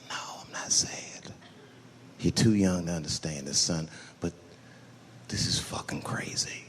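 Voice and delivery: soft, low choked-up whisper. Retold or imitated. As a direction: hold back tears while whispering. Dad answering son, choked up.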